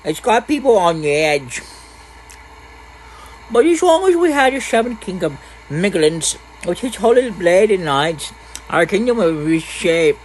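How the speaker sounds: put-on small boy telling the village news; little boy. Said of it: "Oh, a little boy".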